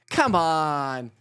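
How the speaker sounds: ordinary talk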